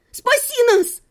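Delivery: high, urgent cry for help; desperate yell. A narrator's reading. Captive calling to her husband for rescue, desperate yell. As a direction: scream for rescue, pitch way up. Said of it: "завопила я".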